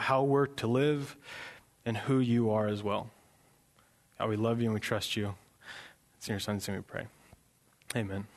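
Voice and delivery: soft, unhurried prayer with long pauses. Real, not acted. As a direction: lead the room quietly in prayer. Leads the congregation in prayer.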